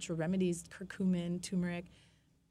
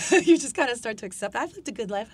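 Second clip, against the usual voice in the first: laughing; 5 semitones higher, 12 dB louder than usual. Real, not acted. Laughing at her own readiness to die.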